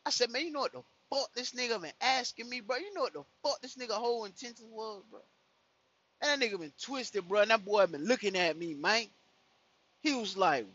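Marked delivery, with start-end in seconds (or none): 0.3-5.2 high-pitched, incredulous venting; baffled, high-voiced retelling; retold disbelief. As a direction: vent to your brother in disbelief, pitched up. Retold outburst to brother.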